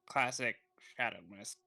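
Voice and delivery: ordinary delivery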